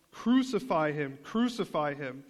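mock crowd cry, stressed first syllable; half-shouted imitation of an angry mob. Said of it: "they cried out".

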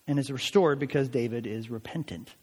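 normal talking voice

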